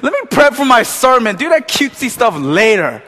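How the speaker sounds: hammed-up annoyance at a friend; mock exasperation. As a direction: pastor moaning at his friend to let him prep. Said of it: "I'm just".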